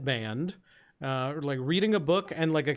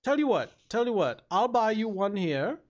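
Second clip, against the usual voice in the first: higher-pitched genteel ogre making an offer; polite ogre, generous; 6 semitones higher than usual. Acted. Ogre offering to buy a newcomer a drink, politely eager.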